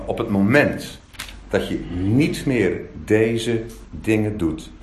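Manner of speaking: low, slow, weighty scripture quote; solemn warning. Acted. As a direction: pronounce it gravely, word by word. Quotes Moses' warning of exile.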